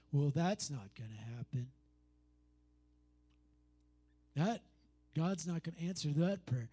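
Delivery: doubting inner voice. Pretend. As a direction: voice the nagging doubt low. Voicing the doubting head.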